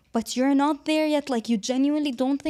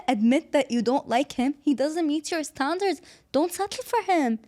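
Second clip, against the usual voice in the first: usual speaking voice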